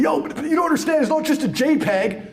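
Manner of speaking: high whiny crypto bro; mocking crypto bro. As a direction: parody an eager NFT fan. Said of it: "people were saying to me".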